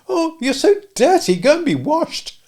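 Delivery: put-on high, loud girlish cry; a dainty lady recoiling from dirt; little lady's cry.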